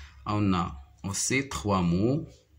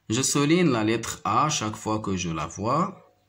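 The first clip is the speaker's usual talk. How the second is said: ordinary talk, nothing marked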